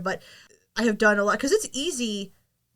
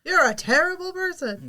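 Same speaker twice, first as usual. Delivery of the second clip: mock announcer; 7 semitones higher, slower than usual. Mock game voice judging.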